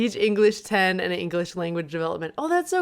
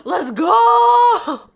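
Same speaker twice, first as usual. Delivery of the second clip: hyped, much higher and louder; excited shout; 12 semitones higher, 11 dB louder than usual. Real, not acted. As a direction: yell it with energy. New year hype.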